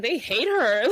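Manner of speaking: laughing. Real, not acted. Laughing at subreddit hate.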